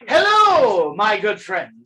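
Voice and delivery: booming high, drawn-out hello; exuberant barkeep greeting. Acted. Barkeep hails the arriving party, exuberant. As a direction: greet them with a big, loud burst. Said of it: "he's like".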